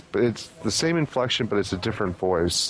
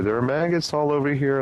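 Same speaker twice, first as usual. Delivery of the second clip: higher-pitched mimicked EVP phrase; quoting a ghostly recording, raised pitch; imitated spirit voice; 6 semitones higher than usual. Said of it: "And then you hear".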